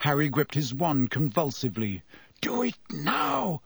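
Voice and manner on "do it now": unvoiced inner-voice whisper; whispery telepathic voice. A narrator's reading. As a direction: whisper it like a voice in his head. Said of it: "said a voice in his head".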